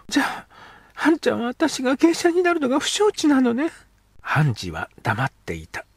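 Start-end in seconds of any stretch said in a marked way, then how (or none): 0.1-3.7 put-on high girl's voice, reproachful; a girl pressing her friend; young girl. Girl character speaks.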